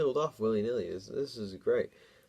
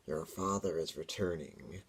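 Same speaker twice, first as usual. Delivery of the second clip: hissing demon; slower than usual. Acted. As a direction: threaten from the dark like a demon. Demon voice hisses from above.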